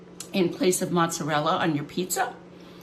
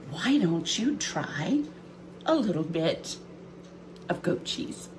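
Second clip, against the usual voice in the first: whisper; 6 dB quieter than usual. Acted. Coaxing tease about goat cheese.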